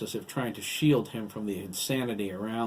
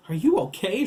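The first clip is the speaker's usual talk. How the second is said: high, loud anxious question; alarmed raised voice; terrified cry; 10 semitones higher, 10 dB louder than usual. A narrator's reading. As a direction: cry out in fear for your child. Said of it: "she cried".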